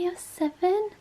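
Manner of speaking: ordinary delivery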